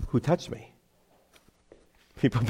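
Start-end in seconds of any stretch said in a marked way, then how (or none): 0.1-0.7 softer, level, deliberate question; understated, unhurried question; calm Jesus asking. Acted. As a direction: ask it quietly and simply. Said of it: "And he says".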